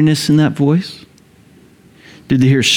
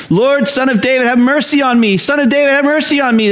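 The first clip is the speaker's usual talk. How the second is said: urgent high plea, half-yelled; mock yelling; 8 semitones higher, faster than usual. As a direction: cry out as over a noisy crowd. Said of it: "these two guys just kinda yelling".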